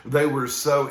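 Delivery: words spilling through a laugh. Real, not acted. Preacher still laughing while telling the congregation, laughing. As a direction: laugh as you start the line. Laughing after his joke.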